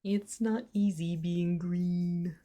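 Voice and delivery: soft, slow frog-puppet impression; sing-song puppet voice, drawn out and hushed; Kermit. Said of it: "That's a pretty good Kermit".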